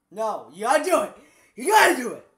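excited yelling. Real, not acted. Excited urging.

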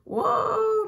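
long, very high, loud whoa; screaming. A narrator's reading. Daredevil zooms by through the air, screaming high. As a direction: holler it as if hurtling past. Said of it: "Mater screamed as he zoomed by".

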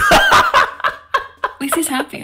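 loud, high laughing words; breaking up laughing. Real, not acted. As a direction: squeal it through laughter. Laughing loudly while joking.

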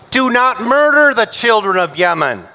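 usual speaking voice

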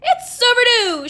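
loud, high accusation; shouted. A narrator's reading. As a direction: shout the charge. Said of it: "shouted super librarian".

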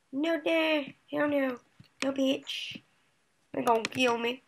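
ordinary talk

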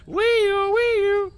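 high, loud wailing siren noise; imitated siren. Player goofing around in game, high siren imitation. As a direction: wail it like a siren.

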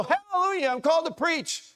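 high, hammed-up hallelujah; a hint of a revival whoop; mock jubilant shout. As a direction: play the over-eager new preacher. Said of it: "run the aisles and go".

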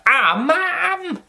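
very high mock whine at Mum; hammed-up, high-pitched embarrassed protest; embarrassed son. Caveman embarrassed by his mum in the film, imitated high whine. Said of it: "she kept embarrassing him".

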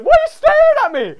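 very high, loud yell; piercing outraged question; shrieked demand. Real, not acted. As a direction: scream it at the opponent. Yelling at staring opponent.

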